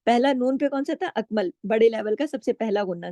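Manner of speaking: usual speaking voice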